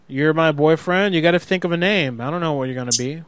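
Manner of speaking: ordinary talk, nothing marked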